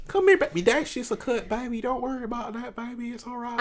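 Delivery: high Southern mother voice; cigarette mom. Retold or imitated. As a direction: soothe a child as a mock Southern mom. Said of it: "You sound like a cigarette mom".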